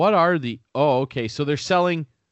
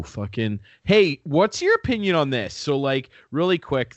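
ordinary talk

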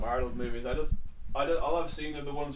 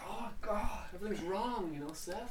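softer, deflated whine; quiet; 3 semitones higher, 10 dB quieter than usual. Retold or imitated. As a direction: mumble it like a beaten man. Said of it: "And you're like".

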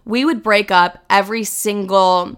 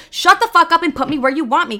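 higher, acted-out exasperated order; mock bossy command, pitched up; impatient outburst; 6 semitones higher than usual. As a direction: cut in, re-enacting your fed-up reply. Retold impatient order to partner.

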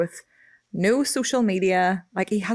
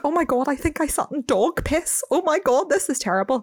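higher-pitched mock horror; retold fake distress; feigned dismay; 8 semitones higher than usual. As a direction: act appalled to cover a fib. Acting out her lie to boyfriend.

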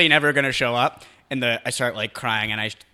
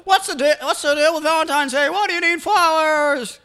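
high nasal-free comic whine, much higher and louder; Seinfeld-style standup bit, pitched way up; Jerry Seinfeld; 4 dB louder than usual. Retold or imitated. Friend mocking Valentine's flowers, doing high Seinfeld impression. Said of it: "Jerry Seinfeld".

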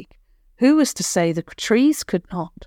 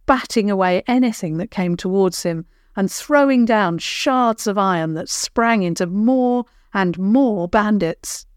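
ordinary delivery, nothing marked

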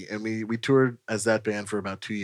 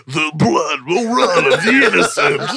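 loud, slow, higher doom-laden proclamation; film character impression; 5 semitones higher, 7 dB louder than usual. Quoting Little Nicky to friends, hammed-up slow proclamation. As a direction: proclaim it slowly like a movie villain. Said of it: "And he's like".

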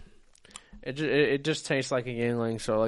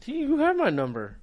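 teasing high voice; 11 semitones higher than usual. Pretend.

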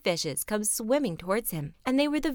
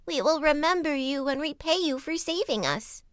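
higher calling fish voices; fishes calling; 7 semitones higher than usual. A narrator's reading. The fishes thank the servant, higher calling voice. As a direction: call up gratefully from the water. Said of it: "cried to him".

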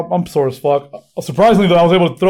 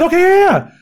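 lightly voiced mom, much higher; high old mom voice; 14 semitones higher, 3 dB louder than usual. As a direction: play the eager grandma, high.

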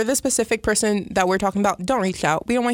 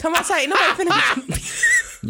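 high-pitched mock call-out throughout; hammed-up challenge; 8 semitones higher than usual. Host repeats the dare for laughs, high hammed-up challenge. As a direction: push the taunt up high to the very end.

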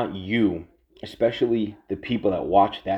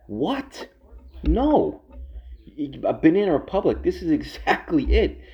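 incredulous outburst; 8 semitones higher than usual. Real, not acted. Rejecting the banana republic claim.

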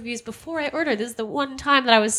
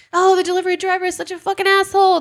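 high, exasperated mock swearing about the driver; owner swearing; 9 semitones higher than usual. The restaurant owner curses the driver to her, in high exasperation. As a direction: vent like a fed-up owner, pitched high. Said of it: "The, uh, owner is now actually swearing".

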